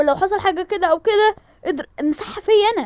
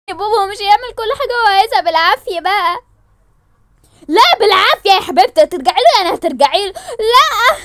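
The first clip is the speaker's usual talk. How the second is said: loud, higher heated fight; angry shouting; 4 semitones higher, 9 dB louder than usual. Acted. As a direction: yell over each other. Shouting match continues. (خناق بصوت عالي)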